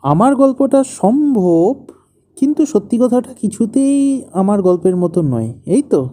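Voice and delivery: annoyed. Acted. Husband sums up wife's doubts about his story, annoyed. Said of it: "তুমি রাগ করছো কেন".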